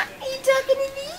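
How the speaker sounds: high, fast, soft cooing. Real, not acted. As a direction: squeak it fast at the puppy. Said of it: "repeats line".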